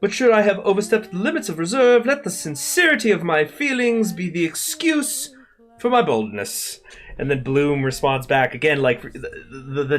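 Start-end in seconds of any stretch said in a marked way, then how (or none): 0.0-6.8 put-on high, fancy old-fashioned Irish gentleman voice; highfalutin accent. Streamer reading Ulysses aloud in a lofty highfalutin accent. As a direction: ham up a lofty Victorian speech, pitched high. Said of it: "I'm gonna switch to the accents".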